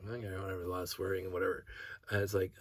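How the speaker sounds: ordinary delivery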